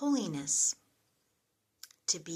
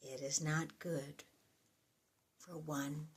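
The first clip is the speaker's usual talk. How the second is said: softer, reflective reading with long pauses; prayerful psalm reading; 5 dB quieter than usual. Acted. Addressing God at the close of a psalm, soft and prayerful. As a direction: let each phrase fall away softly, like a prayer.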